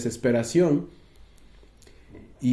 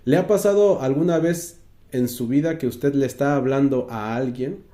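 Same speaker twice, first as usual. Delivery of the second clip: ordinary delivery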